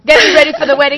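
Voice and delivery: loud, high, excited call; excited cheer. Real, not acted. Host echoing guest's wedding catchphrase, excited and loud. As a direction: belt it out with playful eagerness.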